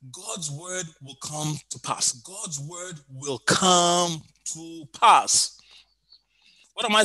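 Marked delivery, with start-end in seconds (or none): 0.1-2.1 quiet declaration. Real, not acted. Slogan repeated, first softer. 2.1-5.6 very slow, drawn-out repeat; deliberate, emphatic pulpit pronouncement; weighty declaration. Real, not acted. Slogan repeated, slower and stronger.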